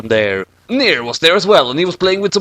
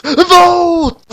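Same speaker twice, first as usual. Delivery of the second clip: shrill squeaky call, much higher and louder; high cry; 5 dB louder than usual. Acted. Urging the listeners to vote, a high loud call. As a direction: shout the single word up high.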